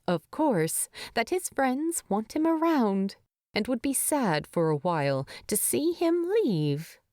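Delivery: ordinary delivery, nothing marked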